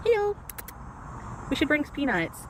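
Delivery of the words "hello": high sing-song hello to an animal; pet voice. Real, not acted. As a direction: coo at it like a pet. Greeting an animal.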